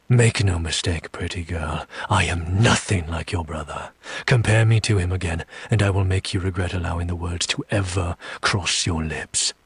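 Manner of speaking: low, controlled threat; menacing warning. Acted. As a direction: drop the pitch and warn them up close. Menacing warning, face inches away.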